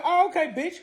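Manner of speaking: high, challenging comeback; high-voiced affronted reply; retold indignant retort. Acted retort after being mugged.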